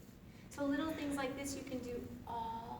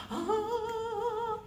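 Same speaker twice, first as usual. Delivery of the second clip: relieved ah; 10 semitones higher, 7 dB louder than usual. Pretend. Relief after sweaty hot yoga.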